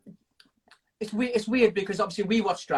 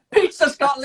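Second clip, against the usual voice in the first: giggling through the words; laughing; 9 semitones higher, 6 dB louder than usual. Real, not acted. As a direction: laugh while chanting it. Chanting the pizza name, laughing.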